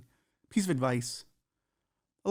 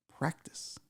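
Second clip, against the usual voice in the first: a breathy stage whisper of the one-word answer; whispered punchline; 10 dB quieter than usual. Pretend. Podcast host whispering the punchline to listeners at home. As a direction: drop to a whisper for the joke.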